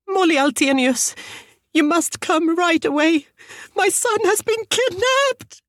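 very high, frantic mother's voice; hysterical scream. A narrator's reading. Mother begging police to come right away, screaming. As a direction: shriek it without much added volume, drawing out the last plea. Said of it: "the woman screamed".

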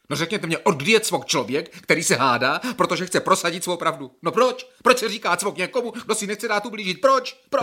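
an aggrieved man who won't be called crazy; indignant outburst. Acted. Patient protesting to the psychiatrist, building to an angry shout.

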